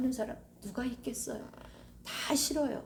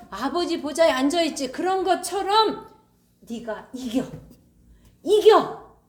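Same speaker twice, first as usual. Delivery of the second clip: high, loud fervent preaching; fiery pulpit climax; preaching at full force; 7 semitones higher, 9 dB louder than usual. Acted. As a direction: preach victory over death.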